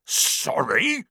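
high, rising bark of disbelief; indignant bark. A narrator's reading. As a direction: bark it back as an outraged question. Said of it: "barked Hagrid".

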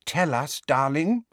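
ordinary delivery

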